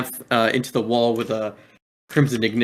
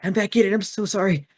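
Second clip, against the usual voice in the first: much higher, eager voice; Tailbane; 9 semitones higher, faster than usual. Acted. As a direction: bounce back up, apologetic and perky. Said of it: "And he goes".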